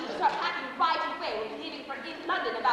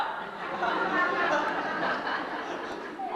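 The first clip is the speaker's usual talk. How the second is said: giddy laugh. Acted. Cedric stutters on the phone.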